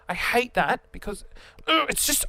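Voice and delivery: usual speaking voice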